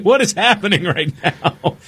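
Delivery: high, baffled voice; mock bewildered. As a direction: act stunned by the chaos. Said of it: "He's like".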